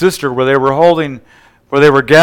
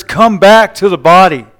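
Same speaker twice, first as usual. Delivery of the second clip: raised, louder preacher's command; commanding the spirits; 5 semitones higher, 6 dB louder than usual. Retold or imitated. Preacher as Jesus telling spirits to return, commanding. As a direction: order them back, high and forceful. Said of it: "Jesus commands the spirits".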